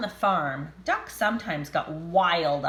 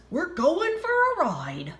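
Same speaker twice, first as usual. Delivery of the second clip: excited dog voice; 9 semitones higher than usual. Acted. Dog's thought voiced.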